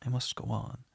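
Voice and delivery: ordinary delivery, nothing marked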